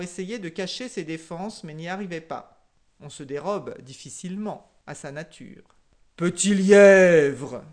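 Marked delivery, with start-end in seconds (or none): 6.2-7.6 loud, slow grumble; grumbling lion. A narrator's reading. The grumbling lion calls the hare, loud and slow. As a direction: growl the name out slowly. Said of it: "dit le lion en maugréant".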